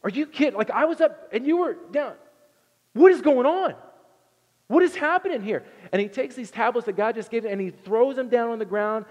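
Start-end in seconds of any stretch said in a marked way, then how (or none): 0.0-5.6 high, loud, incredulous shout; mock outraged leader yelling; exasperated Moses. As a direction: Moses losing it at the people below. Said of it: "is all like".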